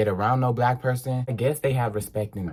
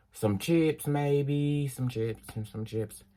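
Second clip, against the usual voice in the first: slow, then fading softer; fading quieter; 3 semitones higher, 6 dB quieter, slower than usual. Acted. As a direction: let it fade away. Trailing snack list.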